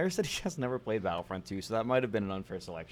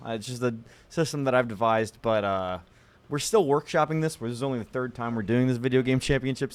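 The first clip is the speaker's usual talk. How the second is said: frantic mock sports commentary; 11 dB louder than usual. Mock championship broadcast announcing.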